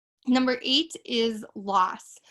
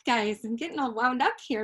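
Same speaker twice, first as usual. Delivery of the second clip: ordinary talk, nothing marked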